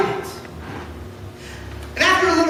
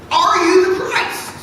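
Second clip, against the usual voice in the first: higher, louder challenge; demanding question; 7 semitones higher, 5 dB louder than usual. Acted. High priest demanding an answer from Jesus, loud. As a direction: press him hard.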